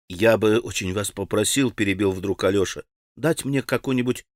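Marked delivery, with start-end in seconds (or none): none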